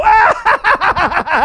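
loud, high-pitched staccato cackle of greed; gleeful shriek. Acted. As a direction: burst into a hammed-up treasure-hoard cackle. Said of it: "get to heaven and go".

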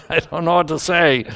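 laughing. Real, not acted. Preacher stumped by a hard verse, laughing. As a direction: laugh while admitting defeat.

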